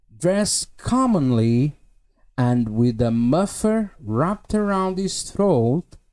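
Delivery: slow, careful English reading; slow reading aloud. Shown as a demonstration. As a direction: read each word out slowly. Reads novel passage aloud.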